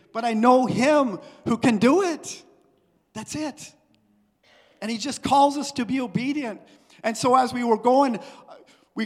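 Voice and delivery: full-voiced sermon delivery; preaching. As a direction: preach it to the congregation. Preaching with force.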